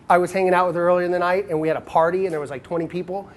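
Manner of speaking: usual speaking voice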